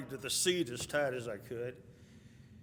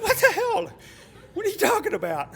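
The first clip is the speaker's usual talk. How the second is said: high, loud incredulous outburst; retold exasperation; 10 dB louder than usual. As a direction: burst out in hammed-up bafflement. Retold friend baffled by the directions.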